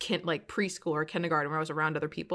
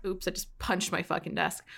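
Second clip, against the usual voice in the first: usual speaking voice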